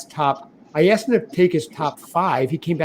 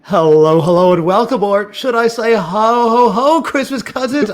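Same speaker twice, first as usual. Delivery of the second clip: big booming show-host intro; announcer voice; 5 semitones higher, 6 dB louder than usual. Acted. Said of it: "I'm announcer voice cousin [name]".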